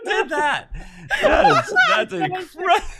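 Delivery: high, laughing disbelief. Real, not acted. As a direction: say it through laughter. Laughing at trick.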